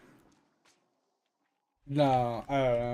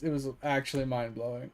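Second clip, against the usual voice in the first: normal talking voice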